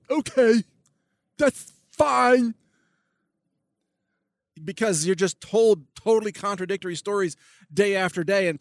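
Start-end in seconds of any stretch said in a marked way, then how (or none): 0.1-2.5 mock brainwashed leftist. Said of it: "you're supposed to go".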